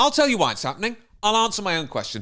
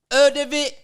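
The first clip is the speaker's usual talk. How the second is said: mock street accent; 5 semitones higher than usual. Child narrator asking about climate change, mock street accent. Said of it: "here's her accent".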